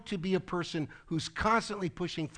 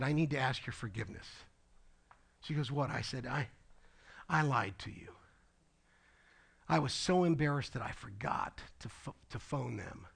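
hushed, sheepish retold apology; low, in-her-ear confession; 4 semitones lower, 8 dB quieter than usual. Pastor confesses a lie to Kyle, hushed. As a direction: confess it softly, close to the ear. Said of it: "I get right in her ear".

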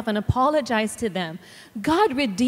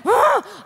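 sharp, higher, louder yell of frustration; retold frustrated scream; 10 semitones higher, 4 dB louder than usual. Mother retelling losing her temper, mock scream. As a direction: let out a mock angry "Ah!". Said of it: "I was like".

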